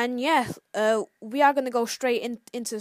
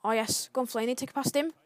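ordinary talk

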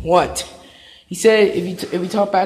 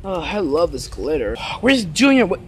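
ordinary talk, nothing marked

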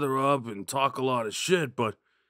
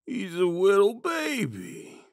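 higher, slow cooing at a pet; baby talk to a rat; 8 semitones higher than usual. Acted.

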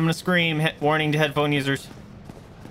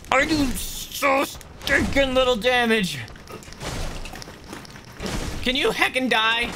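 whiny; 11 semitones higher, slower than usual. Real, not acted. Frustrated whine at weak weapon.

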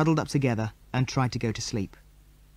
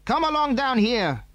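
higher, commanding order from a stranger; commanding man; 10 semitones higher than usual. A narrator's reading. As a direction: order them down. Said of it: "commanded the man".

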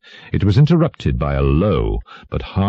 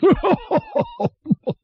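very high kingly guffaw; roaring laugh. A narrator's reading. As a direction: roar with laughter as the king. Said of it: "roared the latter".

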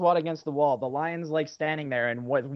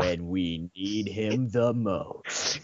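deep wise voice; 9 semitones lower, much slower than usual. Retold or imitated. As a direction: drop to a deep, sage-like intonation. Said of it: "it's something like".

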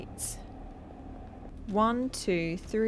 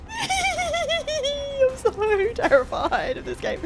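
shrieky whine; much slower than usual. Real, not acted. After scare.

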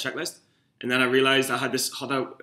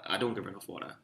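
much softer, low and muttered to himself; quiet guilty repeat; 6 semitones lower, 10 dB quieter, faster than usual. Real, not acted. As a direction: drop the voice as if confessing it.

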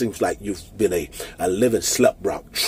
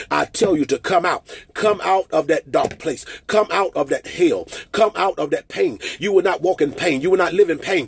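higher, driving declaration, lines rushing faster toward the end; fervent preaching; 12 semitones higher, 7 dB louder than usual. Acted. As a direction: preach at full force, calling them out of the pain. Fervent declaration over congregation.

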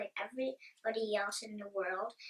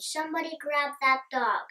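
higher and louder, a storyteller's scream; read-aloud scream; 4 semitones higher, 6 dB louder than usual. A narrator's reading. Manager yelling at his staff to grab the dog, higher and louder. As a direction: bark the order across the store. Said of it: "The manager screamed".

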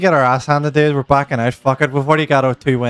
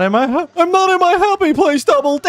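panicked high shout. Real, not acted.

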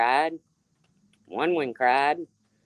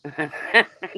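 laugh. Real, not acted. Laughing at chat comment.

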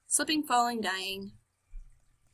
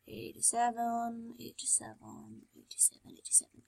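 fading to whisper; 11 dB quieter than usual. Real, not acted. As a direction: let it die away to a whisper. Repeating code, fading out.

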